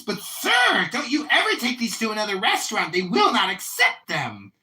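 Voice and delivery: high, loud mock manager; imitating the manager. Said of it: "And he goes".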